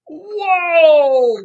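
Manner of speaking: high, loud drawn-out whoa; amazed whoa. Pretend. Storyteller revealing the bag to children, amazed whoa. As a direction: let out a big soaring whoa. Mock amazement after the song.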